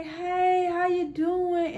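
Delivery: high, drawn-out, loud hello; imitating a high greeting called through a window. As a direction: call it out slowly in a high, friendly sing-out. Calls greeting to granny through car window.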